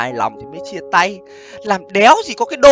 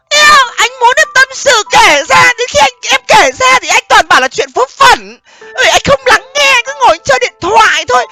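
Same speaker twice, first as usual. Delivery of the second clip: put-on complaining girlfriend; 9 semitones higher, 5 dB louder than usual. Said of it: "Nói lại thì bảo là".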